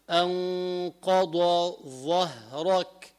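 أنقض ظهرك very slow, ḍād and ẓā' kept apart; separated ḍād and ẓā'. Shown as a demonstration. Teacher stresses the ḍād-ẓā' meeting in أنقض ظهرك. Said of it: "البيان لازم هون".

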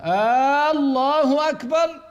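imam's faulty takbir on rising, mimicked; imitating an imam. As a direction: belt out آه، الله أكبر like the imam bowing. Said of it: "عند الخفض وعند الاعتدال هكذا".

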